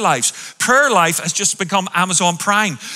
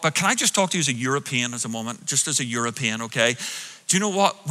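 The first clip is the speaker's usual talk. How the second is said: fast, softer aside; confiding aside; 7 semitones lower, 7 dB quieter, faster than usual. Acted. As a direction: lean in and let them in on a secret.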